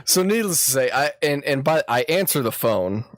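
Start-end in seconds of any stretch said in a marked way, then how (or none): none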